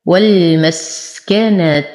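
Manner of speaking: measured والمسكنة, lingering on s, full u on the last t; slow tajweed recitation. Shown as a demonstration. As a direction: chant والمسكنة slowly, ending in a rounded tu. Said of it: "أتم ضمة التاء". (ترتيل والمسكنة مع إتمام ضمة التاء)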